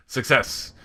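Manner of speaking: loud, high victory shout; triumphant cheer. Real, not acted. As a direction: celebrate the win out loud. Mission success cheer.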